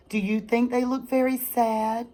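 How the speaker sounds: ordinary delivery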